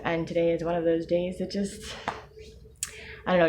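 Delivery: ordinary delivery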